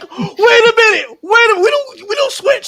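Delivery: high, loud, laughing alarm; half-laughing panic, way up high; alarmed disbelief. Retold or imitated. Passenger realising they didn't switch planes, laughing alarm. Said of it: "I was like".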